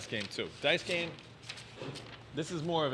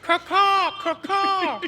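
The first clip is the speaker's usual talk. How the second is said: high screeching crow caws; 3 dB louder, much slower than usual. Real, not acted. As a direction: screech like a crow, loud and shrill. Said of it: "That was well done".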